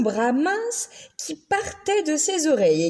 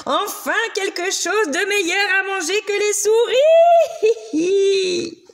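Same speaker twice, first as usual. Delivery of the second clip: higher, laughing monster shout; gloating monster cry; 9 semitones higher, 5 dB louder than usual. A narrator's reading. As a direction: crow over the prey. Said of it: "s'écria la vilaine bête".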